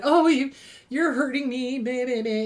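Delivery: lightly whiny, mocking a self-pitying abuser; mock victim whine. Narcissist flipping it, a mild mock whine of hurt. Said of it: "playing the victim".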